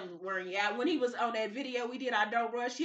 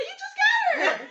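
high, slow laughing whine to her son; a squealing laughing plea; mock whine; 7 dB louder, slower than usual. Mom whining at her son for leaving, high and laughing.

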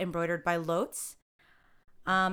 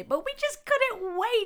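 high squealy gushing; mock excited; 3 dB louder than usual. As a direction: gush with fake enthusiasm.

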